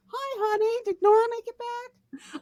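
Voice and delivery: very high, sing-song phone greeting; breezy mother. Retold or imitated. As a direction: mimic an airy mum who acts as if nothing happened. Mom's breezy call days later.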